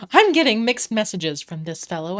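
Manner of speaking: ordinary talk, nothing marked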